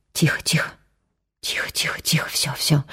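soft hushing whisper; calm her in a whisper; soothing whisper. A narrator's reading. Anton holds Maya and soothes her, whispering. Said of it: "шепнул Антон".

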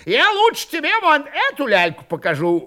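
high, quick, angry threat; heated threat. A narrator's reading. Old man threatens the mocker with a sabre, heated. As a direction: rush the threat out, pitched high.

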